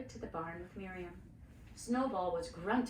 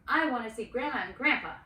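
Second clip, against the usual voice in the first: protesting; 3 semitones higher, 9 dB louder than usual. Acted. Aaron protesting the plan.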